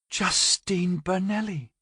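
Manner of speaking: hushed, eager and slow; breathy thrill at a celebrity; breathless excitement. A narrator's reading. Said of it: "with breathless excitement".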